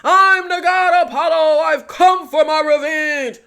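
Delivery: loud, hammed-up hero proclamation; mock battle cry, over the top; Apollo. Mocking the hero who runs off by himself, mock shouting. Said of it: "Who talks like that".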